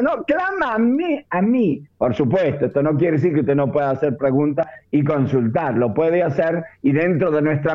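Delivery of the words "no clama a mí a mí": alto y lento, acentuando "clama"; "clama" subido y marcado, "a mí" recalcado; emphatic God quote. Acted. Voz de Dios en la prédica. (el predicador pone voz a Dios, enfático)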